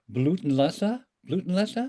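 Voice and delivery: Blutenlese as a tentative question, rising at the end; unsure pronunciation. Real, not acted. Host unsure of a German title, tentative and questioning. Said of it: "I may be mispronouncing this".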